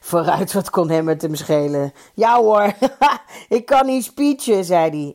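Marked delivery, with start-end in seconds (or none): 2.1-4.6 higher, louder, with a chuckle; amused, raised voice with a laugh; laughing reply. A narrator's reading. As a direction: laugh it off cheerfully. Kees voiced replying in story.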